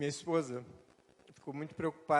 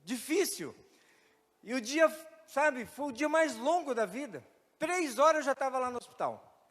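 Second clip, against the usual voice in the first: emotional telling; 7 semitones higher, 6 dB louder than usual. Real, not acted. Emotional testimony, voice raised.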